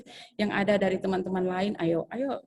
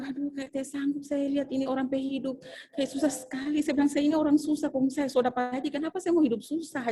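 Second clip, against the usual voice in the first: higher, complaining in disbelief; exasperated complaint; 7 semitones higher than usual. Retold or imitated. Teacher recalls griping about her frugal colleague, exasperated. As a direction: she can't stand living so stingily.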